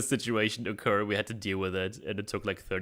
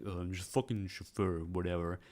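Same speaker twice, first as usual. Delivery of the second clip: sulky mutter; 3 semitones lower, 8 dB quieter than usual. Retold or imitated. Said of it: "It's like".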